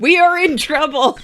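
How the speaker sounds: from another room. Acted. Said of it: "From the other room, you hear".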